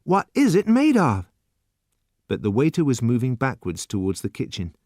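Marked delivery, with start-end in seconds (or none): 0.0-1.3 higher, louder keen asking; hungry to know; eager question. A narrator's reading. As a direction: youth asking the waiter eagerly.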